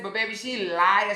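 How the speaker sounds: ordinary talk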